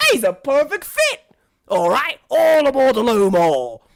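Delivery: loud, high conductor's cry; jubilant chauffeur's call. Acted. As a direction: call everyone aboard with gusto. Chauffeur calls all aboard.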